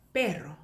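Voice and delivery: "pero" with a trilled double r; low "pero" with a Spanish trill; rolled r. Shown as a demonstration. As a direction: roll the r in the middle of "pero". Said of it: "굴리는 발음인데".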